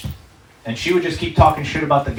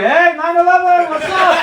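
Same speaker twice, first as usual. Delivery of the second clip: high, loud mock schoolkid hail; affectionate kids' greeting; 5 dB louder than usual. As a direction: call out to a pal, pitched way up. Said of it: "kept calling me affectionately".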